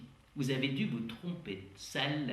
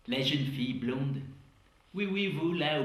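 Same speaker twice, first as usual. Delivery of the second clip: ordinary delivery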